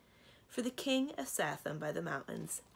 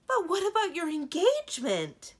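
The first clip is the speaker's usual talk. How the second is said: high, loud horrified cry; 12 semitones higher, 11 dB louder than usual. Acted. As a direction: cry it out in horror. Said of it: "cried, horrified".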